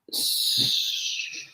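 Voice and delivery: ordinary delivery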